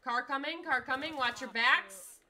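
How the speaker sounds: loud, urgent alert; warning shout. Real, not acted. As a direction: yell the warning to the team. Warning of a car.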